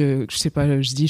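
ordinary talk, nothing marked

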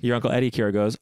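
usual speaking voice

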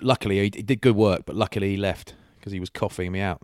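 ordinary talk

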